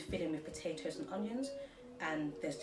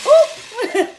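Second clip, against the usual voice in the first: startled exclamation; 22 dB louder than usual. Real, not acted. Whisk sprays potato everywhere.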